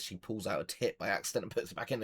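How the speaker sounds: ordinary talk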